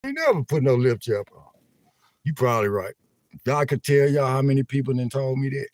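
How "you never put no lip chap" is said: higher, louder mock scolding; playful mock complaint, building higher; imitating viewers. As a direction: tease him like friends fed up. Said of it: "y'all probably like".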